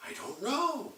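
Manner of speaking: put-on high, soft baby voice; baby boy. Baby boy answering the girl, high soft voice. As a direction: answer like a clueless little baby. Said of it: "Little baby boy's like".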